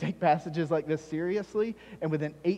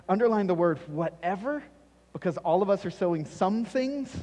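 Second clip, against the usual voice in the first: louder, raised preaching push; 4 semitones higher, 5 dB louder than usual. Acted. Pastor urging his congregation to underline whatever, preaching loud.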